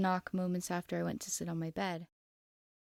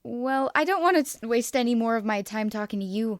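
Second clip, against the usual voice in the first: louder parting jab; a mildly louder mocking goodbye; yelled; 3 semitones higher, 7 dB louder than usual. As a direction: call it out over the shoulder, louder. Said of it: "I yelled".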